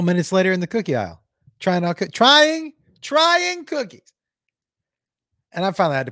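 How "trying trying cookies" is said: high, loud, stretched disbelief; exasperated high-pitched repetition; incredulous outburst. Real, not acted. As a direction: climb higher on the repeat, appalled. Disbelief at the man's cheek.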